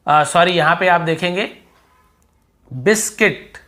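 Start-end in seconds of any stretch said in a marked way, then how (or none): 2.7-3.6 बिस्किट ending in a plain "kit", higher; silent U. Shown as a demonstration. Teacher shows the word under the rule, silent U. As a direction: say बिस्किट with no W sound. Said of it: "यू को नहीं पढ़ना है".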